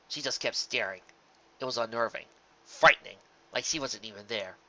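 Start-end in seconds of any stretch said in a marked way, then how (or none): none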